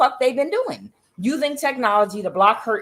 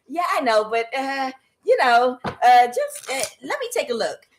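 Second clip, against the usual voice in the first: higher, halting mock plea; hesitant staffer; 5 semitones higher than usual. Staffer pleading to review the speech, mock hesitant. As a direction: meekly press for a look.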